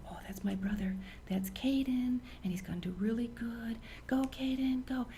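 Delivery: timid, barely audible cheer; hushed, half-whispered rooting; too quiet cheer. Shown as a demonstration. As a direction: cheer so softly the player can't hear. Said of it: "Can Caden hear that?".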